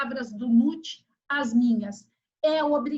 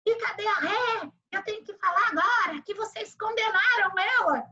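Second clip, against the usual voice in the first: higher-pitched imitation of a judge ranting; mock judge yelling; 7 semitones higher than usual. Judge complaining after the verdict, mock yelling. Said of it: "ela começou a gritar".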